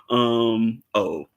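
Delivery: ordinary talk, nothing marked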